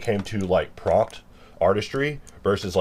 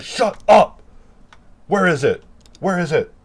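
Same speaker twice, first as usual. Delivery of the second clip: much higher, louder hammed-up yell; mock yell; 11 semitones higher, 6 dB louder than usual. To the human asking why: hammed-up higher yell. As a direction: scream like an impatient boss.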